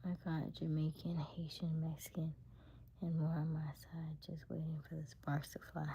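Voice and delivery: playful hushed boast; whispered tease. Real, not acted. Boasting to viewers about her options, whispered. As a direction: whisper it like a secret threat.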